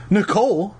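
high, anxious calling; worried call. A narrator's reading. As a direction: call her name, scared. Calling to frozen sister.

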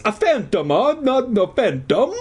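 high, questioning stage-Italian voice; surprised Italian accent. A narrator's reading. Janitor puzzled at being called the Phantom, surprised Italian accent. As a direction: puzzle over it in hammed-up Italian. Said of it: "in surprise".

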